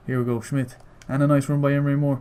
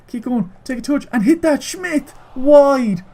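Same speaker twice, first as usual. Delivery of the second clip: much higher, building to a loud cry; rising shout, then loud dismay on wide; excited yell; 10 semitones higher, 5 dB louder, slower than usual. Real, not acted. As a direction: yell the miss as it happens. Missed chance in the game.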